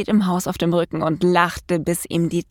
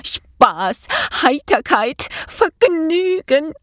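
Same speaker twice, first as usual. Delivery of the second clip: drawn-out words climbing higher each time; whooping with glee; 8 semitones higher, much slower than usual. A narrator's reading. Mouse delighted by his prank, jubilant. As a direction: whoop each word higher than the last. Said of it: "juchzte er".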